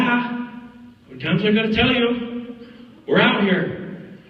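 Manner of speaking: high, emphatic, spaced-out retort; re-enacted fed-up arguing; acted exasperation. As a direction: hammer it home in separate bursts. Acted quarrel with co-star.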